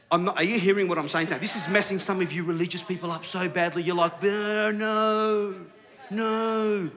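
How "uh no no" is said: drawn-out, droning "no"; droning mock objection; mock protest. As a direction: mimic a pious objector, stretching each no. Mocks religious people's drawn-out objection.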